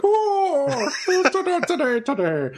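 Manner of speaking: a really bad Irish accent, said high. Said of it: "like, really bad Irish".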